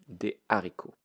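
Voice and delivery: Des haricots with a clean break, no z before haricots; no liaison before haricots. Shown as a demonstration. Teacher to class: Des haricots, break, no liaison. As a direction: pause between Des and haricots, no linking. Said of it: "We will make a break here".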